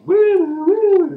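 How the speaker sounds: very high, loud woo woo like a police siren; siren imitation. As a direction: wail it like a cop car.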